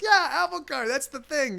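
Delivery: high giddy wonder; euphoric. Retold or imitated. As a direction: gush with tripping delight. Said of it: "I'm like".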